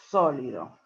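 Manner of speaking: «Sólido» con la primera O acentuada; acento en la O. Shown as a demonstration. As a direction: marca la O inicial de «Sólido». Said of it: "con acento en la O". (acento en la primera sílaba de «Sólido»)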